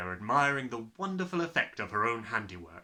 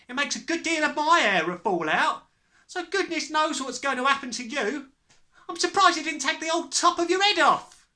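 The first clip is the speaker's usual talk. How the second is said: high, raised mother's voice; loud warning; 8 dB louder than usual. A narrator's reading. Mother telling her husband the danger, loudly. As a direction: press the warning home loudly.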